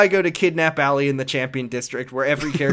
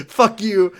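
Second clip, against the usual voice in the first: a louder, higher mock shout; mock yell; 7 semitones higher, 7 dB louder than usual. Kiryu cursing at the spoiled kid, mock shout. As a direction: fire it back, half in jest. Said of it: "he's just like".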